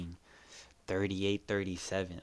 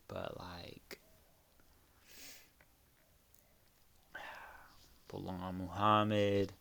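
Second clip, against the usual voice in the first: whispering; 7 dB quieter, slower than usual. Real, not acted. Hushed aside about the favourite.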